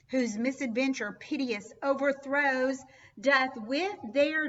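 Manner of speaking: loud, declaiming Shakespeare with rising force; dramatic poetry reading. Acted. Teacher performing the prologue for the class, declaiming loudly. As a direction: proclaim the prologue like an actor on stage.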